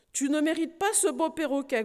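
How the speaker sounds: mild stern rebuke; scolding. Acted. Aunt scolding the knight, mildly stern. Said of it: "gronda-t-elle".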